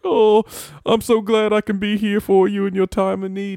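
high, loud weepy gratitude; imitated tearful comforting; mock crying. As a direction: blubber it out, hammed up. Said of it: "like, crying".

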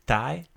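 "thigh" said as taj, hard T with tongue on front teeth, no th sound; Croatian taj. Shown as a demonstration. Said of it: "you got that T that hits against the back of your, uh, front teeth".

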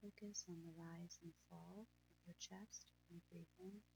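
breathy, hushed calming guide; guided meditation. Acted.

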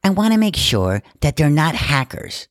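high, put-on snobby golfer woman; snooty lady voice. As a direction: sniff at the hackers in her voice. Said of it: "She goes".

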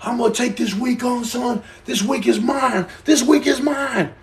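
high, fired-up self pep talk; hyped-up vow. Retold or imitated. Replaying Sunday's confidence, hammed-up hype. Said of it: "I go from".

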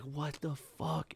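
muttered disbelief. Retold or imitated. Stunned by Instagram post.

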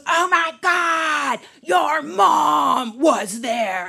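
high, drawn-out mock wail; aghast boyfriend. Boyfriend running up to dancer about her mom, aghast. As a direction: stretch each word in comic horror.

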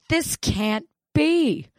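high, slow, drawn-out denial; can't believe it, stretch it out; mock horrified, high and slow; stunned disbelief. Disbelief at test result.

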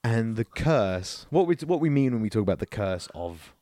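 ordinary talk

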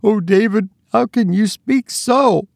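high, tearful wife's reproach; sobbed. Acted. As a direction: plead with him through tears. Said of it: "sobbed his wife".

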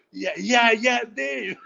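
a lightly played stoner grabbing at the name; eager stoner. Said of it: "you could hear in his voice".

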